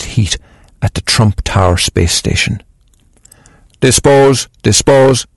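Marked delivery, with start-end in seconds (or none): 3.8-5.3 robot voice. Acted. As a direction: repeat it like a machine. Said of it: "she heard another robot say".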